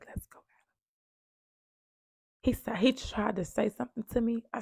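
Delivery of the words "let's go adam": very faint, breath-only whisper. Retold or imitated. Mother quietly getting Adam out of the house, whispering. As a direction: re-enact the hushed order to leave. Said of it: "I do a whisper".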